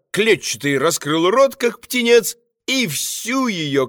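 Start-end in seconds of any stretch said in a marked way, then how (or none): none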